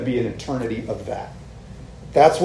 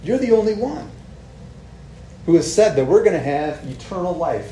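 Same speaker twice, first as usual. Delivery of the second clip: earnest Peter; 5 semitones higher than usual. Retold or imitated. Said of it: "He's like".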